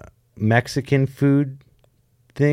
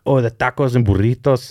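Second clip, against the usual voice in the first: rolling the Spanish hard on tacos and burritos; heavy Mexican accent. Retold or imitated. Overdoing a Spanish accent on food words. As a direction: lay the Mexican accent on thick. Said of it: "really go super hard on".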